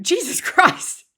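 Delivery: high, loud, slow laughing disbelief; shocked laughter. Real, not acted. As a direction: wince and laugh at the harsh line. Laughing at the harsh text.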